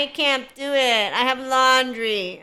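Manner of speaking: slow, drawn-out whiny excuse; mock whine. Coach mimics someone excusing themselves from development, mock whine. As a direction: whine it out, mocking the excuse-maker. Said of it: "somebody who whines at doing personal development".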